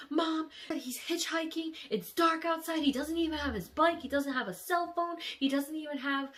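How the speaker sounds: frantic about him on a dark road; panicked worry. Retold or imitated. Panicked call to mom.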